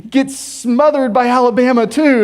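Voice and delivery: loud, high pumped-up football fan; fired up. Acted. Fan watching Aggie football clips, getting fired up. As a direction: blurt it like a hyped-up fan. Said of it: "you're getting fired up".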